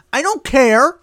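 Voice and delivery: loud high I don't care; mock outburst. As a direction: snap back loudly. Voicing Hamilton dismissive.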